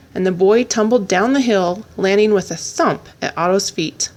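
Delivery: ordinary delivery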